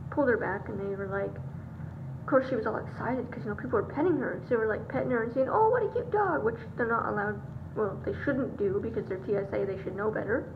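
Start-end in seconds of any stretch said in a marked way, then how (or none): 5.5-6.4 high-pitched mock fawning over a dog; put-on doggy baby talk; imitated cooing. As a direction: coo like a smitten stranger petting a dog.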